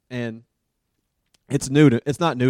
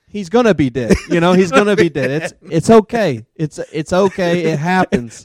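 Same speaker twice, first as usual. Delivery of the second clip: mock casual reassurance; 3 semitones higher, 9 dB louder than usual. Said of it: "I'm like".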